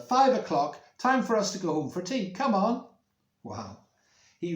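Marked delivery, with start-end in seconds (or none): 0.0-2.8 high, loud announcement; calling the child home as grandad; grandad's voice. Acted. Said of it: "says Grandad".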